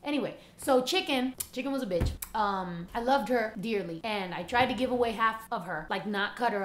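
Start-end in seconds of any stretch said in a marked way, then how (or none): none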